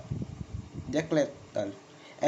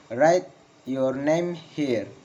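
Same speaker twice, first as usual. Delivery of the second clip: very slow, word by word, "here" falling; slow falling intonation; much slower than usual. Shown as a demonstration. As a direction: spell out the command slowly for learners. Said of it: "Failing intonation in comments".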